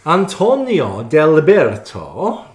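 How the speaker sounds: put-on slow, loud Italian accent. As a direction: roll the name out Italian-style. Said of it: "can I put on my Italian accent".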